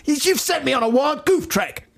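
very high, comic mock outrage; imitated shout. Replaying the manager's mangled phrase, high mock shout. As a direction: squeal it as the fuming manager. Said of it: "He shouted".

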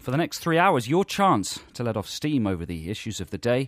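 high, punchy talk-radio intro; mock phone-in host. Host opening a spoof political phone-in, mock presenter voice. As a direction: sell it like a hard-hitting political phone-in presenter.

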